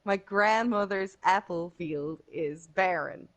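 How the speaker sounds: slow American A's in "My grandmother's apple field is barren"; American accent. Shown as a demonstration. Irish player showing a friend American A's, slow and careful. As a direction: flatten every A American style in "apple" and "barren". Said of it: "Which in American would be more like".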